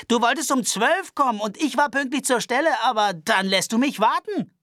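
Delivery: high-pitched nervous Albert voice. Acted. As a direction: complain fussily and fast. Albert's character voice.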